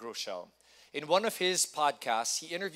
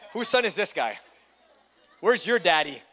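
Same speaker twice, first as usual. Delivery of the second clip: loud playful call to the room; loud teasing shout to the congregation; 2 semitones higher, 9 dB louder than usual. Real, not acted. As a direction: call out across the crowd. Calling out to the crowd.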